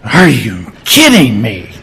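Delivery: incredulous. Retold or imitated. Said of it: "it literally means".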